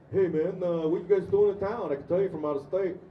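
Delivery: loud New Yorker accosting strangers; strong New York accent. Retold or imitated. Stranger on subway greeting visiting actors, imitated New York accent. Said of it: "people with that strong of an accent".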